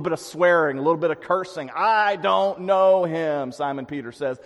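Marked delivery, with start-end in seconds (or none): 1.7-3.5 slow, emphatic denial, somewhat louder; Peter's denial. Retold or imitated. Peter denying Jesus, a touch of anger. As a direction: spit out a hard denial.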